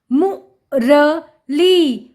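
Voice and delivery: मु, र, ली slow and separate; sounding out. Shown as a demonstration. Teacher reading to children, syllable by syllable. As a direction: split it into मु... र... ली. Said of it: "उच्चारण करके पढ़ लिया".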